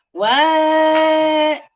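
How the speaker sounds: high, loud drawn-out what; hammed-up disbelief; mock astonishment.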